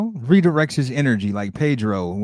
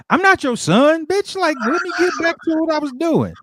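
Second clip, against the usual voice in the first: much higher put-on voice; offended stranger on the couch; indignant young woman; 14 semitones higher than usual. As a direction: snap back at the wrong accusation. Chick wrongly accused.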